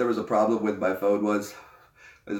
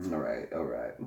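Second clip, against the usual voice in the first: normal talking voice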